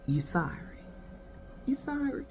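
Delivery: soft, mostly low mock disbelief; quiet scornful disbelief. Woman answering his apology, acted quiet scorn. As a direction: imitate her quietly doubting the apology.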